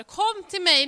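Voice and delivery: loud, high call to the crowd. Retold or imitated. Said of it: "står Jesus och ropar".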